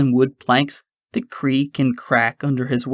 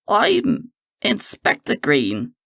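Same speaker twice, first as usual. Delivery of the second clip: slow, higher, slightly put-on gentleman; lightly voiced Professor Green; 6 semitones higher, slower than usual. A narrator's reading. Green lying to the constable, slow and higher. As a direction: draw out the false name with slow poise. Time lord lies about his name.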